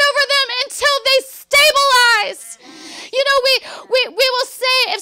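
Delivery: loud, driving pulpit declaration; preaching at full force. Acted. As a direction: preach it with full conviction to the church.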